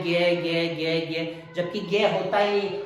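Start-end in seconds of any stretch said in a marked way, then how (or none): 0.0-1.3 "ज्ञ" said gya, over and over; repeated gy sound. Shown as a demonstration. Teacher mimicking what we have always said, gy sound. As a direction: recite "ज्ञ" as gya in a lowered chant. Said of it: "ये बोलते आ रहे हैं".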